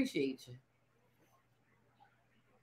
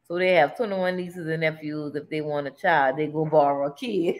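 ordinary delivery

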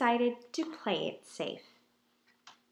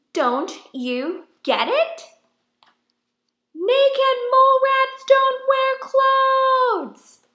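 high, loud, slow shouted rule; exasperated shout; 13 dB louder, slower than usual. Acted. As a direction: yell it, drawn out and fed up. Mole rats lay down rule.

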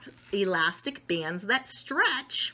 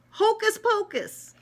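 magic spell; 11 semitones higher, 4 dB louder than usual. Pretend. Child tries a magic spell.

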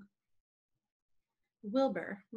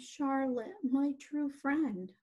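higher, slow lament; moaning; 5 semitones higher, slower than usual. Acted. Wilbur to his dying true friend Charlotte, moaning. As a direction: drag the words out in acted grief. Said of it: "he moaned".

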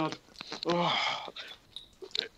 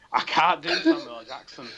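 usual speaking voice

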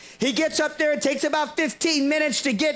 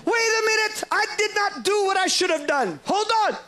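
high called-out plea from the crowd; high calling out wait; shouted interruption; 7 semitones higher than usual. Retold or imitated. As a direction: call out to stop the prayer. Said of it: "Someone else shouts out".

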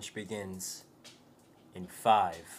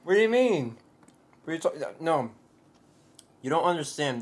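excited protest; 7 semitones higher, 9 dB louder than usual. Acted. Mock argument with chat.

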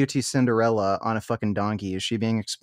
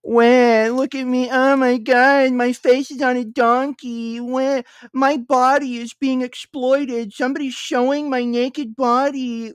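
much higher, slow, whining crybaby voice; mock crybaby wail; 11 semitones higher, 4 dB louder than usual. Streamer parodying her complaint as a crybaby wail, high and slow. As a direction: wail it like a spoiled baby, hammed up. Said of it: "crying about it".

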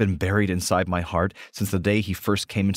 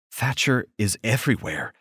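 ordinary delivery, nothing marked

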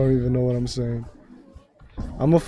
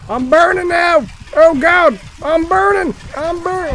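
very high, loud mock screaming; frantic play-acted wail; hammed-up panic; 7 dB louder than usual. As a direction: shriek as if your game character is on fire. Character is burning in game.

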